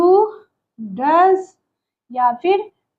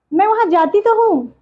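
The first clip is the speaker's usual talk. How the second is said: तो stressed, voice lifted higher on मैं वहाँ जाती तो हूँ; emphatic reply; 5 semitones higher than usual. Shown as a demonstration. Teacher insisting she does go, stressing तो. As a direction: insist with weight on तो. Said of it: "means जोर देके बोल रहे हैं".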